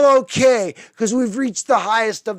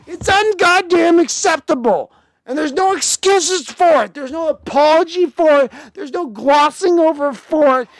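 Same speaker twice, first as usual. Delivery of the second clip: higher, louder heated ranting; furious rant; 6 semitones higher, 4 dB louder than usual. Real, not acted. Fan raging that there are no excuses. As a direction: pound each line out. Angry rant about coach.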